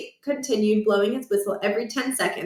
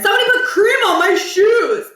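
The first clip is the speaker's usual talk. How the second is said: outraged yell; 11 semitones higher, 6 dB louder than usual. Acted. Josh outraged, loud.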